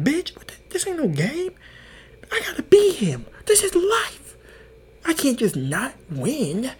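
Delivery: high-pitched indignant rant; hammed-up gamer outrage, voice way up; outraged retort. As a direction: insist it's life or death. Acted outraged retort about losing game.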